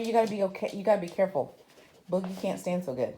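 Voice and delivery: ordinary talk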